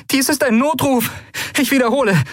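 high-pitched distress call; urgent calling. A narrator's reading. Pilot broadcasting an emergency call, urgent calling. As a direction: send the mayday high and urgent. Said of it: "rief sie in den Kubusaufzeichner".

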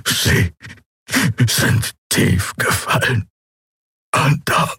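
slow, breathy, broken creature voice; dying prisoner mocking his captors, voiceless; rasping tortured creature. A narrator's reading. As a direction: barely get the words out.

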